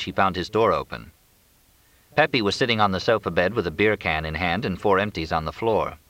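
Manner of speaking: usual speaking voice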